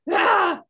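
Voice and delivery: a loud, high yell of frustration; exasperated shout. Acted. After retelling her family's pushing, a loud frustrated Ah. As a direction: let out a mock scream of exasperation.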